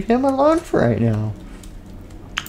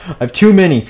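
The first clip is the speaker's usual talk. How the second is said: higher, louder exasperated burst; exasperated outburst; 7 semitones higher, 6 dB louder than usual. Acted. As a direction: blurt it out in frustration. King exasperated.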